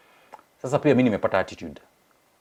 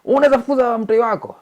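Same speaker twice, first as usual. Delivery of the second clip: much higher and louder, aghast; shrill disbelief, much louder; outraged; 10 dB louder than usual. Retold or imitated. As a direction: protest a mother throwing out her child. Laughing outrage to neighbor.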